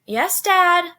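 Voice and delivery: high, loud answer; calling back. A narrator's reading. Mary answering her father's summons, calling back. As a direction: call back to a parent.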